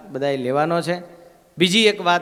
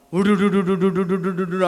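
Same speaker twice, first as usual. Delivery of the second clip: rushing crowd noise. Retold or imitated.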